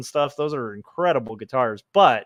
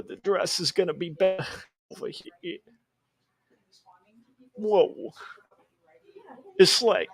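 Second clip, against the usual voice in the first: goofy stream voice; 5 semitones higher than usual. Pretend. Keeps the goofy persona.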